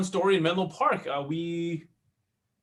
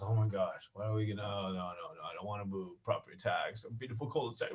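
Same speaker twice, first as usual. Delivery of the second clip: much softer, lower muttered protest; grumbling husband; 8 semitones lower, 12 dB quieter than usual. Retold or imitated. Husband resisting wife's new house, soft and low. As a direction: grumble it under your breath. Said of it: "My wife keeps wanting".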